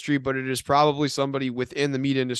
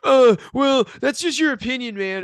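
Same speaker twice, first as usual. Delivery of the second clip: high, loud smug whine; a hammed-up know-it-all dismissing the argument; mocking vegan voice; 10 semitones higher, 6 dB louder than usual. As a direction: mimic a smug vegan brushing you off. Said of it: "And then they're like".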